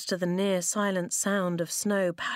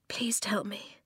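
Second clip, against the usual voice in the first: soft pleading whisper; whispered plea; 7 dB quieter than usual. Acted. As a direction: beg him under your breath. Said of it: "Eska whispered".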